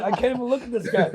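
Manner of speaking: high-pitched mock exasperation; mad, retold with laughter. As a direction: act too mad to look, amused underneath. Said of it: "He was so mad".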